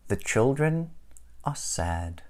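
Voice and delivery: ordinary delivery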